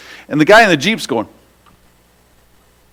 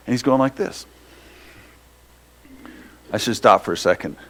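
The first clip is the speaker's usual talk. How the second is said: normal talking voice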